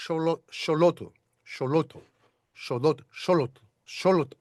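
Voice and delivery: "Xolotl" tried repeatedly, SHO-lo-tl; fumbling tries at "Xolotl" with SH; struggling attempts. Shown as a demonstration. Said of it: "I'm not gonna be able to get it right".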